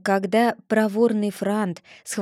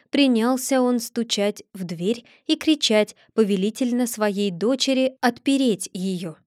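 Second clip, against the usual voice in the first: usual speaking voice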